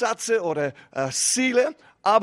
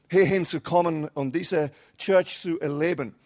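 normal talking voice

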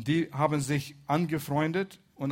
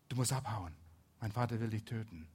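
ordinary talk

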